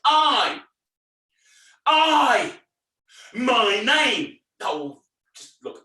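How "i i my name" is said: loud right answer. Shown as a demonstration. Entertainer demonstrates the correct reply for children, loud. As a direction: call it out high and loud. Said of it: "Let's try it one more time".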